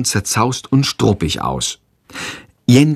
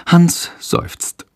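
ordinary delivery, nothing marked

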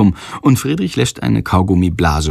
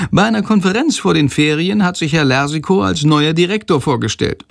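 high, lively storyteller figure; chatty Sándor, obliging; 8 semitones higher than usual. A narrator's reading. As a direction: recount it eagerly in a high character voice. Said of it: "erzählt weiter". (hoch und bereitwillig erzählend)

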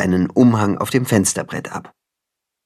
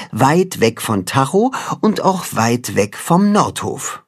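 usual speaking voice

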